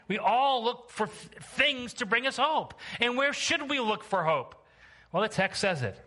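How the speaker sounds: high, emphatic sermon voice, building; preaching. Real, not acted. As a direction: drive the point home to the congregation. Preaches with rising intensity.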